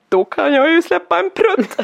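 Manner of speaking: put-on boy promising his uncle a fart; cheeky little boy. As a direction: savour the naughty promise like a child. Child voiced in retold story.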